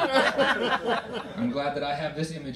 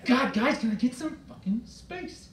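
high pleading whine; exasperated plea; 9 semitones higher, slower than usual. Retold or imitated. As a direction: beg the kids for space. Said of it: "exasperated plea in shower".

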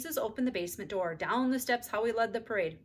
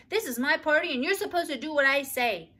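bossy; 7 semitones higher, 7 dB louder than usual. A narrator's reading. Bossy child at her party.

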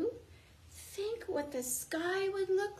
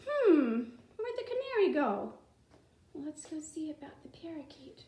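ordinary talk

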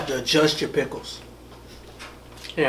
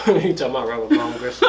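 laughing; 3 dB louder than usual. Real, not acted. Teasing after odd word choice.

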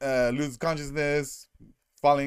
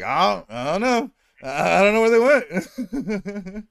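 higher, louder, slow helpless shrug; clueless Fury; 7 semitones higher, 6 dB louder than usual. Retold or imitated. Fury answering the council, mock clueless. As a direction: stretch out the not knowing. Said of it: "And Fury's like".

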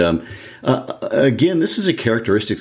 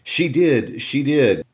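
higher, lightly voiced chanting taunt; mild mock singsong jeer, higher; taunting boy; 7 semitones higher, slower than usual. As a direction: needle her like a spiteful child. Children's quarrel, read aloud.

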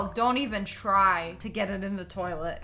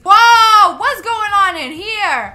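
loud, higher astonished shout; shocked whoa; 8 semitones higher, 13 dB louder, slower than usual. Real, not acted. Player bursts into the janitor room, loud surprise.

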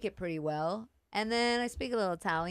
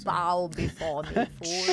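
mock royal command; 3 semitones lower, slower than usual. Guest plays haughty royalty.

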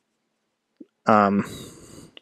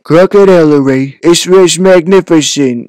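loud, slow, raised-pitch politician impression; Donald Trump; 6 semitones higher, 5 dB louder, slower than usual. Streamer retrying after too much inflection, bad politician impression. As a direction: draw it out in a bad presidential voice. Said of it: "Every time I try to do Donald Trump".

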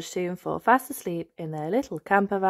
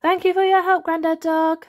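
high, louder grateful mum; cheerful higher thanks; Mummy Pig voice; 11 semitones higher, 7 dB louder than usual. Acted. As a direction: thank him warmly and brightly. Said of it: "says Mummy Pig".

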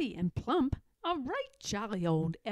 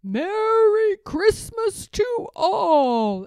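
slow, loud, booming goodbye; exclaiming farewell; 6 semitones higher, 11 dB louder, much slower than usual. A narrator's reading. Saint Nick calling goodnight from his sleigh, slow loud exclaim. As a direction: boom it out slowly as he drives off. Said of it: "But I heard him exclaim".

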